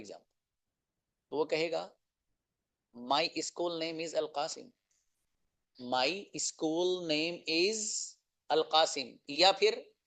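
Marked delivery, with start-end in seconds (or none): 5.8-9.1 very slow, each word spaced out; slow model answer. Shown as a demonstration. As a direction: stretch it out for students to repeat. Repeats answer slowly for learners.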